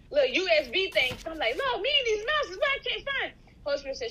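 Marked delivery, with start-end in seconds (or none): 1.6-3.3 whiny plea. Retold or imitated. Whining prayer to God.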